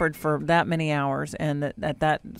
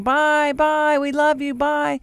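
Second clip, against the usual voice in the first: put-on high, loud child's farewell; retold high singsong byes; little girl calling goodbye; 10 semitones higher, 5 dB louder, slower than usual. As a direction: imitate a child calling after someone as she runs. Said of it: "little girl starts running through the cemetery saying".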